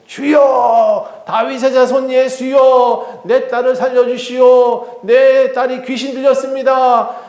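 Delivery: high, drawn-out pleading shout; crying out. Acted. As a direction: wail it out like a desperate mother. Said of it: "얼마나 소리를 지르는지". (길게 부르짖으며 애원)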